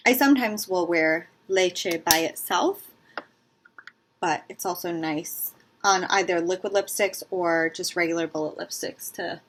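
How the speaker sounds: ordinary talk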